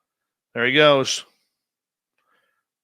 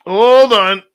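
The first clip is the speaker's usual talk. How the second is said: louder, higher call to stop; loud hold on; 7 semitones higher, 10 dB louder than usual. Real, not acted. Host stopping the show, loud and high. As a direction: halt everything, loud.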